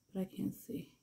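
hushed whisper of frustration; soft whispered complaint. Real, not acted. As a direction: whisper it while concentrating. Whispering during clipping.

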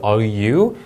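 Are you? with a rising end; "Are you?" said slowly, rising on you; rising tune. Shown as a demonstration. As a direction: lift the pitch on "you?". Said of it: "일반 의문문은 올려읽어야죠".

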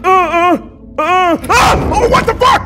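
very high, loud startled yelps; panicked screaming. Real, not acted. Player caught by who's moving, panicked screaming. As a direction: shriek in short bursts of fright. Jump scare in game.

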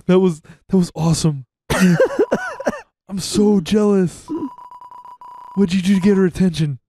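fast, excited buddy voice with laughs; cartoon sidekick voice. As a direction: hype up your friend like a goofy sidekick. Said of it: "you do that voice so justice".

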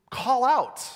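"call out" lifted high and loud. Shown as a demonstration. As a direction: let "call out" ring out. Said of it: "You raise your voice".

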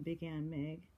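plain reading voice

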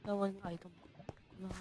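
very soft muttering to oneself. Real, not acted. Player talking to themselves while sorting items, muttering. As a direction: talk under your breath, barely voiced. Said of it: "muttering, not moaning, muttering".

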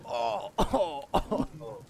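gasping mock groans after a fall; hammed-up winded moaning; acted winded. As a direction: gasp as if the wind got knocked out. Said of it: "knocks someone out of you".

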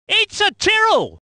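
high, punched car name; excited identification. Acted. Commentator spotting a Tyrrell, high excited call. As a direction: lift it into an exclamation.

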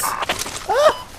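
sudden high yelp of alarm; a real startled cry. Real, not acted. Enemy vehicle on them.

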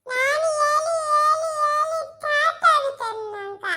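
high, slow tearful complaint; ಅಳುತ್ತಾ ದುಃಖದಿಂದ; crying. Acted. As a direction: sob the words out, worn out from pleading. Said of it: "ಅಳ್ಬೇಡ ಸುಮ್ನಿರಮ್ಮ".

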